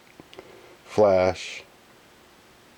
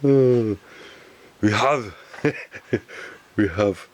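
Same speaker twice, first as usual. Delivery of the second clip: normal talking voice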